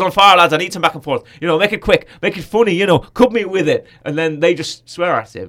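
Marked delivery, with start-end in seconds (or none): 0.0-3.9 loud Irish coach goading the lads; a hint of an Irish accent, pushing hard. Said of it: "he goes".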